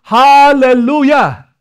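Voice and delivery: loud, high, drawn-out hallelujah; slow exultant worship cry; shouted praise. Acted. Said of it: "sinong sinisigaw?".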